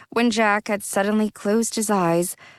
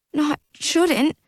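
sniffling; 8 semitones higher than usual. Acted. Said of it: "Jack sniffled".

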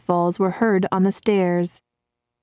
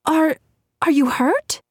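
high, breathless alarm; girl alarmed after a fall; gasping; 10 semitones higher than usual. A narrator's reading. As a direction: gasp it out in shock. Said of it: "Nancy gasped".

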